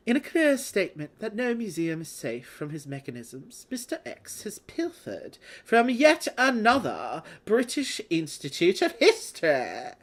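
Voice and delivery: put-on dramatic British news-report accent; a theatrical British newsreader voice; bad British accent. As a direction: read it like a hammed-up London newspaper. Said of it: "do a bad British accent".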